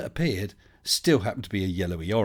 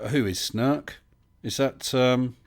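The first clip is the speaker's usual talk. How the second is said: ordinary talk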